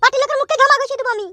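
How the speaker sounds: high, defiant woman's voice; a wife waving off the party's threat, pitched high; defiant wife's voice. Acted. (উঁচু গলায় জেদি বউ)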